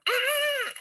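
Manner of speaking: mock scream of a frightened kid; high-pitched imitation of a child's scream; retold scream. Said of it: "he screams".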